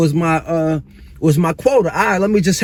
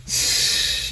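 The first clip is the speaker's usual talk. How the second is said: heavy sigh; 7 dB quieter than usual. Real, not acted. Sigh after losing everything.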